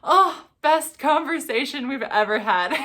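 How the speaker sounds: bubbling over with joy; laughing. Real, not acted. Recalling happy phone call.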